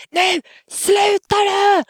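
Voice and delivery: high-pitched, drawn-out shouted command; re-enacted screaming at a child; retold scream. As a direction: yell it slowly at the top of your range. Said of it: "skriker honom och bara".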